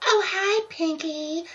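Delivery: high, loud cartoon greeting; hammed-up high pony hello; Applejack calling out. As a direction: call out across the farm in a high voice. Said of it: "Applejack yelled".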